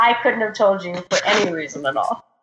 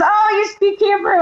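surprised Israeli; 11 semitones higher than usual. Retold or imitated. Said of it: "And she says".

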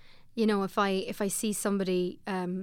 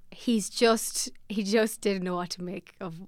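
usual speaking voice

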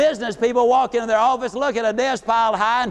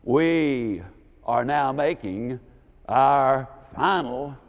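much lower, slow, resonant captain on the intercom; mock airline captain, lower and drawn out; deep pilot voice; 9 semitones lower, much slower than usual. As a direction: boom it out like the captain announcing landing. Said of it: "in that deep, resonant voice".

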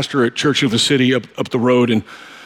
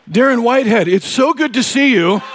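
mock enthusiastic greeting; 7 semitones higher than usual. [name] greets wrong Darren warmly.

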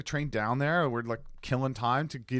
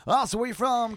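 higher-pitched, eager small-talk opener; chatty tipsy passenger; 10 semitones higher, 4 dB louder than usual. Retold or imitated. Chatting up the Uber driver after drinks, talkative. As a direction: imitate being overly talkative after drinks. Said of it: "I'm always very talkative".

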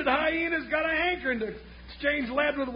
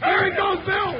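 shout; 4 semitones higher, 5 dB louder than usual. Acted. Spotting the fleeing outlaw.

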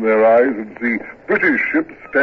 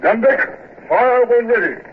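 shouted order; 10 semitones higher than usual. Acted. Ordering gun deck to fire.

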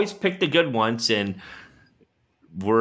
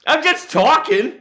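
high-pitched protest. Real, not acted. Cohost defends his interrupting joke, high flustered protest.

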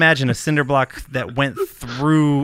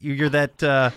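usual speaking voice

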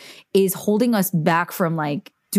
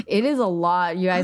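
ordinary talk

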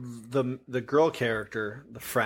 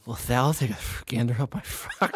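silly put-on character voice; playful character impression; Uncle Rumple impression. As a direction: ham it up as a movie creature, close to laughing. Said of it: "what does he say?".

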